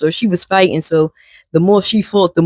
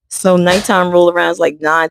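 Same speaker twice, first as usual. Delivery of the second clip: normal talking voice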